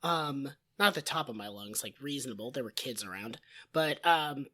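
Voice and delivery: blown-out raspy voice; hoarse voice. Real, not acted. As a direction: speak through a shredded throat. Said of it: "husky, raspy, sexy voice".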